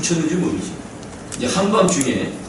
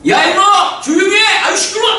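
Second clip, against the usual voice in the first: much higher, loud angry shout to shut up; hammed-up furious yell from the cells; prisoners yelling; 4 dB louder than usual. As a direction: bark at the singers like annoyed inmates. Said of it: "죄수들이 뭐 했다?".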